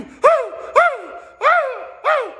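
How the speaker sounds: imitating a man hooting in panic on a dropping plane; mock panicked hooting. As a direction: hoot like a terrified man on a plane. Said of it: "screaming like this".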